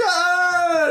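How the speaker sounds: long, high-pitched scream; mock terrified scream. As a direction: scream as if imitating a fleeing hero. Tim flees tentacles.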